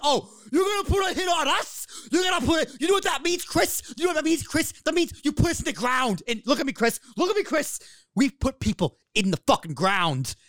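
high-pitched, incensed wrestling promo rant; Eddie Kingston. Retold or imitated. Co-host acts out wrestler threatening rival, high angry shouting. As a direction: imitate an outraged wrestler confronting his rival, voice pushed way up. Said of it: "Eddie's just like".

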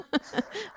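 a quick high giggle of surprise; startled little laugh; high surprised laugh. Real, not acted. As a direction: burst out laughing in disbelief. Surprised at stranger's question.

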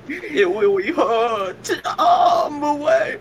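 put-on high, slow childish whine; mock baby wail. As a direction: wail it like a hurt toddler. Babyish parody, wailing.

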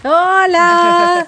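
high, bright sung greeting; sing-song hello. Real, not acted. Co-host greeting a radio listener, high sing-song hello. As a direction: call out a cheerful hello.

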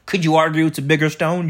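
ordinary talk

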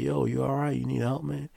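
high, urgent check-in; concerned call. Retold or imitated. Guard to fallen man, high concerned call. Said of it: "I'm like".

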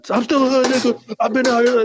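high, loud hammed-up rapper brag; mock rapper voice. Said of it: "We got".

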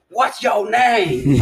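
high, slow demanding voice; mimicking the inmate. Imitating an inmate demanding an officer's name, high and slow. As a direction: stretch it out like a demand.

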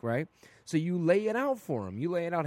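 normal talking voice

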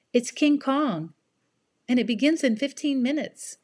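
put-on chirpy excited teen friend; cheerful young girl. Cindy voiced.

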